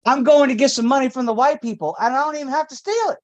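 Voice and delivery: high, fast and eager; giddy dealer. Pretend. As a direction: rattle it off gleefully. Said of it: "Where are you going?".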